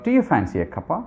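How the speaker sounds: fast, breezy "Do you fancy a cuppa?" with rising end; quick casual offer. Shown as a demonstration. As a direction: rattle it off lightly like an everyday offer. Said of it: "They say that".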